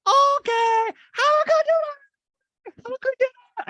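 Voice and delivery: put-on very high, loud squeaky kid voice; little boy asking his mom about the time; extra cute child voice. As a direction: make it as cute as you can. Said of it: "I can't even do it as cute".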